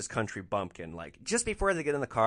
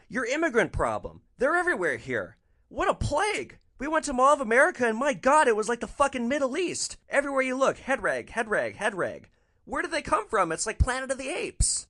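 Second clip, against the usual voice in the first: much higher, louder shrill-voiced caricature of the woman; 11 semitones higher, 5 dB louder than usual. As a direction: ham up the passenger's outraged rant, pitch way up. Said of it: "And she goes".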